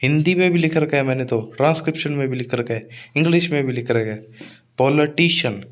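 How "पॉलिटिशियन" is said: पॉलिटिशियन as pol-uh-TEE-shun; short "luh", single "shun" in पॉलिटिशियन; corrected pronunciation. Shown as a demonstration. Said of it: "पोली नहीं". (पॉलिटिशियन का सही रूप "पोल-अ-टीशन")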